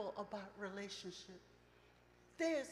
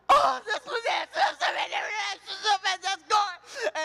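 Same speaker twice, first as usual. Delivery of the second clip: crying outburst; 9 semitones higher, 13 dB louder than usual. Retold or imitated. Retells her own sobbing outburst.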